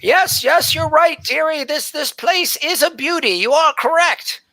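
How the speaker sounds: high, fluttery voice of an ancient elven woman; flustered old elf scribe. Acted. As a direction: play a scatterbrained old elf surprised by visitors. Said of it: "old scribe answers the dwarf".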